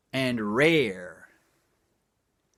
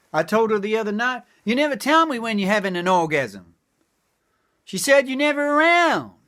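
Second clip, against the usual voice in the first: high excited punchline; 7 semitones higher, 4 dB louder than usual. Acted. Wife quote punchline.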